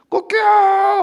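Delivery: sudden loud high cry; shouted call. Acted. Heavenly call to Peter, shouted. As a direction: bark it out.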